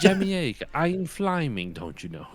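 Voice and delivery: put-on silly affected British accent, starting high and falling away; hammed-up strange British impression; Ian Fleming. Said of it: "his affectation of a British accent".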